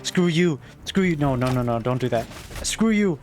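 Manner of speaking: high triumphant jeering; mock the beaten enemy; gloating taunt. Real, not acted.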